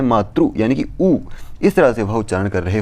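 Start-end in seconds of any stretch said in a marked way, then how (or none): 0.0-0.5 मातृ said as matru, ending in u; wrong pronunciation. Shown as a demonstration. Teacher showing students the wrong मातृ, ending in u. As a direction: demo the faulty मातृ with a u ending. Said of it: "उच्चारण वहाँ पर कैसा कर रहे हैं".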